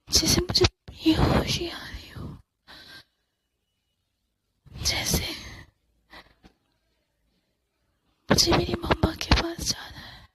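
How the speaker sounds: slow, breathless, fading whisper; a terrified girl fading out, whispering for her mother; faint whisper. Acted. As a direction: barely get the words out, fainting. Fainting, losing voice.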